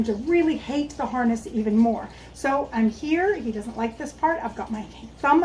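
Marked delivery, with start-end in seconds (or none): none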